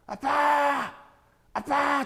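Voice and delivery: put-on high, sleepy child's cry of "아빠"; child calling dad. Child waking in the night, calling for dad, high and plaintive.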